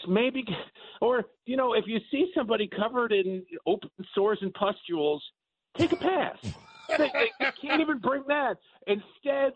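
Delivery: high, laughing, barely holding it together. Real, not acted. Guest laughing at his own monkeypox joke, high and giggly. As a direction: crack up while pushing the joke on.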